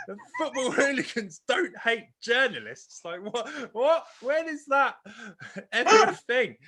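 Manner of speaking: high-pitched, laughing disbelief; incredulous. Real, not acted. Mocking the hooligans' hatred of journalists, high and incredulous. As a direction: squeak out the disbelief, half laughing. Mocking absurd film line.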